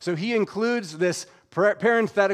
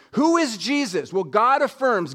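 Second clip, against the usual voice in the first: ordinary talk, nothing marked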